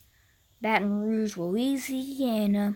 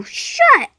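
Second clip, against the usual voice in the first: higher, louder snap; shouted retort; 13 semitones higher, 11 dB louder than usual. Acted. State yelling at another state to shut up. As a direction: yell it back.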